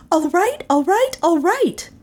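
high, hurried run of all-rights; exasperated giving in. Acted. Character giving in to Doan, exasperated. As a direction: give in with pitch thrown up high.

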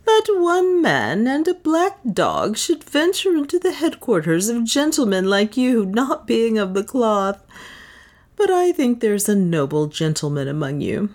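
amused adventurer, unhurried; Leonard. A narrator's reading. Leonard as Captain Pierre.

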